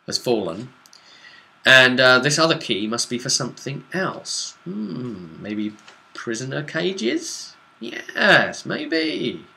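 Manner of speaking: ordinary delivery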